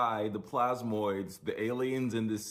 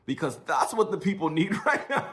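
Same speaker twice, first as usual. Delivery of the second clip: higher voice breaking into a laugh; 7 semitones higher than usual. Real, not acted. Ranting to viewers about the pope's timing, laughing. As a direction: speak through disbelieving laughter, pitched high. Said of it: "I'm not even laughing".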